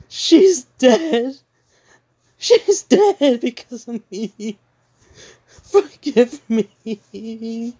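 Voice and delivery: high, loud crying, slowing to a plea; sobbing confession. Retold or imitated. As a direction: break down in tears, then beg slowly. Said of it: "Ruby's confused".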